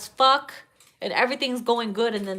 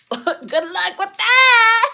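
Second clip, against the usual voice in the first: high, slow, laughing sarcasm; sarcastic laugh-cry; 11 semitones higher, 7 dB louder, much slower than usual. Real, not acted. Laughing at the request.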